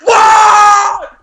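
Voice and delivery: huge high screamed answer; as loud as possible. Retold or imitated. Son yelling back at mom's second call, all-out shout. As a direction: blow up from two to ten. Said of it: "as loud as I possibly".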